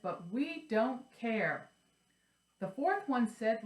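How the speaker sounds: lively sing-song read-aloud; reading a children's rhyme. Acted. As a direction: read it like to little kids. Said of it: "I'm gonna read this".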